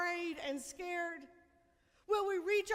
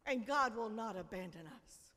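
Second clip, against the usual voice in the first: usual speaking voice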